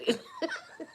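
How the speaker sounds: high-pitched burst of amused laughter; helpless high giggle; real laugh. Real, not acted. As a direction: crack up mid-story. Laughs at brother's mishap.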